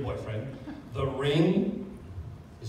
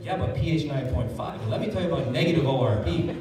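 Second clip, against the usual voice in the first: eager rushed pitch; 4 dB louder than usual. Retold or imitated.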